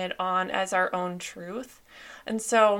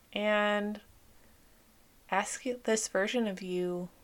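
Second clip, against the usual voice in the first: guided meditation. Acted. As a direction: gently lead them back to childhood memories. Leads a guided inner-child visualization.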